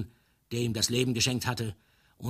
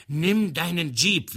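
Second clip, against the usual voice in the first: booming, higher, slow command; stentorian voice; 7 semitones higher, 7 dB louder, slower than usual. A narrator's reading. Karaplasm demanding his friend run him over, booming. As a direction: boom it out like a stentor. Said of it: "mit Stentorstimme".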